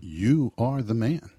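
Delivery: slow, loud, weight on "You"; a prophet's slow pointed verdict; slow solemn accusation. Retold or imitated. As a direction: lean hard on "You" and let "are the man" fall slowly. Jesus could have confronted elders.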